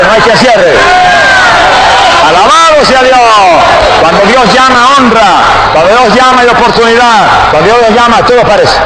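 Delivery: high, driving, rhythmic exhortation; full-force preaching. Real, not acted. Preacher promising the church that God opens doors, full-force preaching. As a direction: build from firm exhortation to ringing proclamation.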